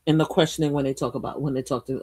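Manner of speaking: normal talking voice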